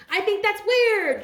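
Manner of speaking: high, loud and slow, leaning on weird; exaggerated put-down. Telling the jealous haters off, high loud drawn-out scorn. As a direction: stretch it out, mocking.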